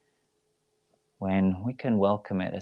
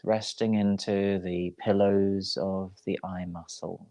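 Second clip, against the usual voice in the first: guided meditation. Real, not acted. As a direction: ease them into rest, unhurried and even. Leads a yoga nidra relaxation.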